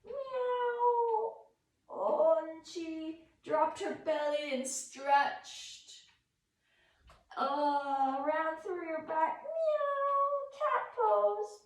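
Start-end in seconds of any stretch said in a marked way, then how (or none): 0.1-1.3 high playful meow; cat meow. Shown as a demonstration. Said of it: "Can you show me cat pose?". 9.4-10.3 high playful meow; cat meow. Shown as a demonstration. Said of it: "Can you show me cat pose?".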